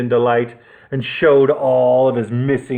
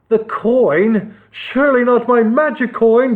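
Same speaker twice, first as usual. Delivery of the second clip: high, raised outraged bellow; outraged at the loss; 8 semitones higher, 3 dB louder than usual. A narrator's reading. Nestor the Nasty learns his coin is gone, bellowing. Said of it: "bellowed Nestor the Nasty".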